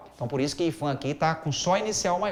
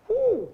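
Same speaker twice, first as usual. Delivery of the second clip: high delighted whoop; 4 dB louder than usual. Real, not acted. Exclaims over good essay.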